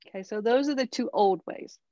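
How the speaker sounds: ordinary talk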